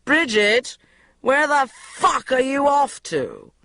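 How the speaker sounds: loud, slow angry boss; yelled. A narrator's reading. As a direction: bellow across the office. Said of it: "yelled Richard".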